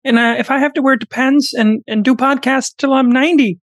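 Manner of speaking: high, tense lecturing voice; Jordan Peterson impression. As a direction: preach about young men, pitched high. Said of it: "does Jordan Peterson's voice not sound almost perfect?".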